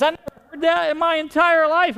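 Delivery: retelling the bank lady's astonished reply; amazed bank lady. Retold or imitated. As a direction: imitate the stunned teller. Bank lady reacts, retold.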